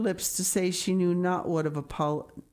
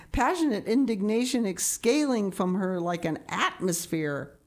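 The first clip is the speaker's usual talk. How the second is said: plain reading voice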